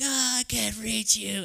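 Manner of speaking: slow, high, overdone desperate strain; melodramatic straining. Retold or imitated. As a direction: ham up the desperate reach. Wrestler dramatically reaching out.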